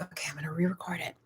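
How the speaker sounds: fast under-the-breath whisper. Retold or imitated. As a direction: rush it out in a whisper. Retelling own thought, whispered.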